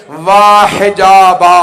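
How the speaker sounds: long drawn-out loud cry of mourning; wailed lament. Acted. Rabab mourning with hand on head, wailing lament. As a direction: stretch each word into a loud wail. Acting out the bereaved mother's lament.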